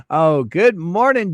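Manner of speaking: normal talking voice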